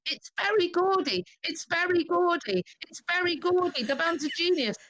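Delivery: high, repeated fan cry; excited shouting. Retold or imitated. Fan jumping up and down as Berry Gordy comes out, excited shouting. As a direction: shout it high like a thrilled fan, slightly held back.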